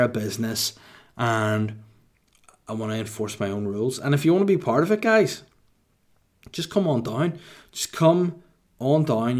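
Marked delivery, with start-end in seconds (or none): 4.0-7.4 higher, fast rallying call to the crowd; hyped invitation. Acted. Calling recruits to join. 7.7-9.4 "Just come on down" stretched slow and high; slow drawn-out call. Acted. Repeated invitation, drawn out.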